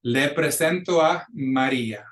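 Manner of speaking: le presento a Maria slowly in clear Spanish; model pronunciation. Shown as a demonstration. Teacher filling in the name for students, le presento a Maria slowly modelled. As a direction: sound out le presento a Maria for learners. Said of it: "gonna pronounce those words for you".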